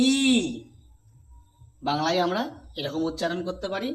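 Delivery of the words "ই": ই held long; long Sanskrit i. Shown as a demonstration. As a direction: stretch the ই out. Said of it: "যার সঠিক উচ্চারণ হচ্ছে".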